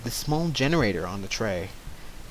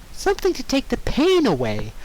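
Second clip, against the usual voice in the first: much higher, sugary sweet; high sing-song Pinkie; 11 semitones higher than usual. Acted. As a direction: soothe her falsely, sweetly.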